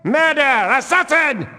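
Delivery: high, urgent alarm cry; alarm shout. Acted. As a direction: yell for help as you find the body. Guard raising the alarm.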